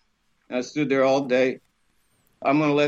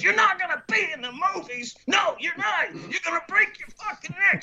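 very high-pitched scolding yell; yelling and cussing. Retold or imitated. Lady cussing at the fence climber, high outraged yell. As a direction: imitate a furious telling-off from afar. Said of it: "She was yelling and cussing".

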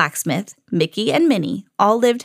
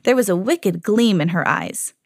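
plain reading voice

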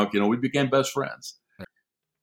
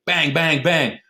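kids playing war, calling out bang; mock gunshot calls; 7 semitones higher, slower than usual. Team chasing, mock gunfire calls.

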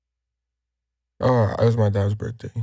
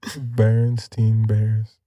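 slow savoring; much slower than usual. Pretend. Joins the word game.

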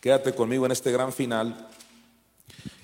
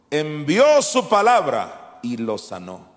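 proclaiming; 3 semitones higher, 8 dB louder, slower than usual. Acted. Preacher proclaiming psalm.

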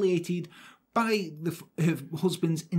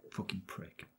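muttered curse; 9 semitones lower, 15 dB quieter than usual. Real, not acted. Mutters insult after in-law's jibe.

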